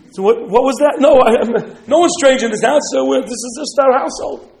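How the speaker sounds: loud, high, stumbling protest; acted nervous insistence, words tumbling out loud; flustered denial. Householder denying a stranger to the soldiers, flustered.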